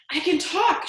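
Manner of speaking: high, loud joyful cry; shout. Acted. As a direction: shout the good news. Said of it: "shouted Jenny".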